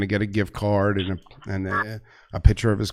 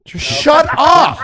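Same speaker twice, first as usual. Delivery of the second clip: high, slow, loud yell; shouting; 10 dB louder, much slower than usual. Real, not acted. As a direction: yell it at the top of your lungs. Yelling at caller to stop.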